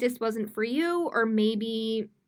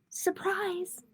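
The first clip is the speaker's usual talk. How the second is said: excited reveal; 8 semitones higher than usual. Acted. Repeated reveal in story.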